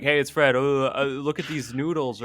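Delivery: high-pitched YouTube character imitation, lightly done; Fred. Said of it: "the one with the high-pitched voice".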